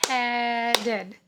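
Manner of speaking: "headed" stretched out syllable by syllable; think-to-spell pronunciation. Shown as a demonstration. Teacher helping a student spell, slow sounded-out "headed". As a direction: draw out the first syllable of "headed". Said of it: "let's think to spell".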